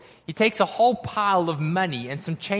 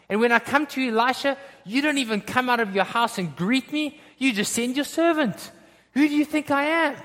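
mounting indignation, climbing higher; Naaman; 5 semitones higher than usual. Acted. Insulted commander berating Israel for sending a servant, indignant. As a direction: pull rank, pitch rising to an affronted question. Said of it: "Naaman says".

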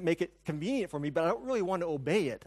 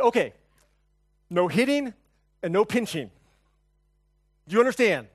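louder, firm fatherly warning; stern dad scolding; 4 semitones higher, 7 dB louder than usual. Retold or imitated. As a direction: lay down the law to the kids.

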